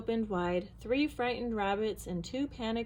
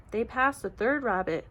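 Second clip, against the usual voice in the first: ordinary delivery, nothing marked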